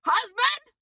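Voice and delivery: scoffing disbelief at a would-be husband; incredulous. Real, not acted. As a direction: repeat the word like it's absurd. Incredulous echo of his wish.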